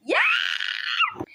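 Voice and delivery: long drawn-out high shriek of dismay, slow; a stretched high "yaah" at a kitchen spill; shocked cry. Real, not acted. Spills coffee, cries out.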